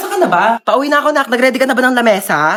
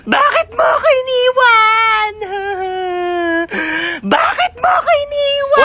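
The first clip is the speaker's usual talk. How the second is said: wailing cry; 12 semitones higher, 5 dB louder than usual. Acted. Mourner cries over the dead at the wake, loud sobbing wail.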